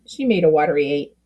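ordinary delivery

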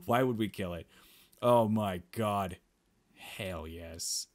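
ordinary talk

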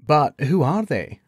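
confused. A narrator's reading. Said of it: "asked Gawain in confusion".

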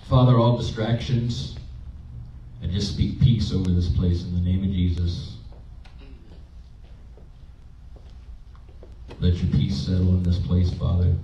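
soft, hushed prayer speaking peace; quiet prayer. Acted. Pastor praying peace over his church, hushed and soft.

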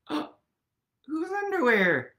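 slow, drawn-out amazed question; surprised gasp. Acted. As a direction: savour the surprise reveal.

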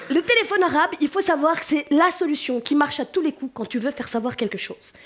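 ordinary talk

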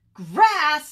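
loud, high, aghast question; appalled disbelief. Acted. Bird is offered grass by Sheep, appalled. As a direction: blurt it out, appalled.

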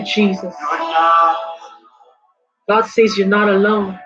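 ordinary talk

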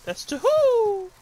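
excited kill count. Real, not acted. Counting kills in a gunfight.

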